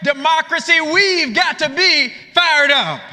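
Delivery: high, ringing political speech with stretched key phrases; full-force rally oratory. Real, not acted. Speaker rallying progressives against those in power, impassioned full-force oratory. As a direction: preach it to the hall, punching the refrain.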